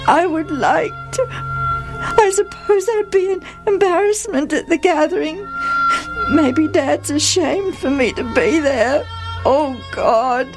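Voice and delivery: put-on high, broken, tearful boy's voice; sobbing. A narrator's reading. Jephthah outside alone, praying to God through sobs. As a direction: sob a halting prayer, catching on the words. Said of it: "He sobbed".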